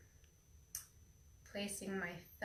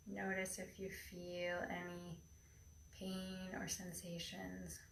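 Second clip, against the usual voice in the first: airy breathy mystical healer; 9 dB quieter than usual. Acted. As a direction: breathe each word into calm stillness. Leads a guided meditation.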